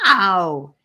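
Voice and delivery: loud yelp of pain; a loud protesting ow. A narrator's reading. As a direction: yelp as he is pulled over. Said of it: "he protested loudly".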